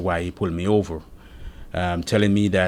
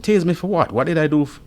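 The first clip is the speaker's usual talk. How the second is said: higher-pitched, disbelieving question; retold protest; 9 semitones higher, 4 dB louder than usual. Driver threatened with taser asks why, protesting. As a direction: challenge the threat, as if reliving it.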